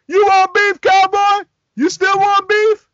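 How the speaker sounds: high, loud yelled challenge. Retold or imitated. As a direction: yell the dare up high at the cowboy. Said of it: "Master P is like".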